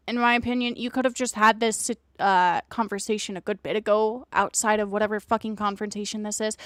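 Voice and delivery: normal talking voice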